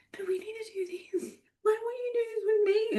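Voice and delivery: tiny, high whispery plea; mock pleading. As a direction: plead in a small, soft, squeaky voice, imitating a needy partner. Acts out pleading with busy husband.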